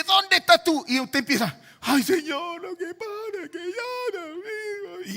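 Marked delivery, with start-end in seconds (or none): 0.0-0.8 loud, high-pitched demand as God; God calling out. Acted. God questioning the praying believer, calling out loudly. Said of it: "cuando viene Dios y dice". 1.8-5.1 high, drawn-out wailing plea; mock tearful prayer. Preacher imitating himself praying to the Lord, tearful mock wail. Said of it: "yo estoy imitándome a mí".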